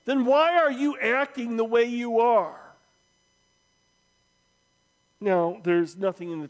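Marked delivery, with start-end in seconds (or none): 0.0-2.7 heckler's louder, higher challenge from the crowd; indignant shouted question; shouting out. Acted. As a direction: call it out over a crowd. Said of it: "and shouting out".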